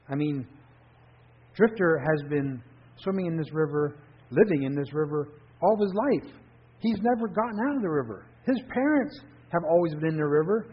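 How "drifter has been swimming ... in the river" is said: usual speaking voice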